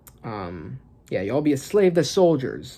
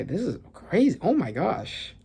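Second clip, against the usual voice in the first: ordinary talk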